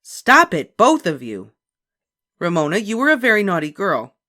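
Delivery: loud stern mother; stern scolding. A narrator's reading. Mother scolding both girls, loud and stern. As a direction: scold firmly. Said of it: "cried Mother".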